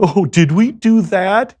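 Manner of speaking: high, slow, nerdy sitcom whine; mock the cute TV screwup, hammed up; sitcom goof. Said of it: "in the movies, they make that funny and charming".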